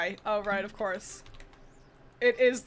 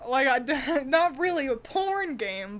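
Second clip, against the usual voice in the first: ordinary talk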